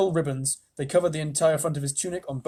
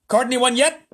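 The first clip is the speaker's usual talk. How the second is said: high, loud punchline; president punchline; 9 semitones higher, 9 dB louder than usual. A narrator's reading. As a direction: deliver it triumphantly. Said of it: "said the president".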